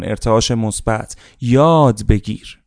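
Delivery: ordinary delivery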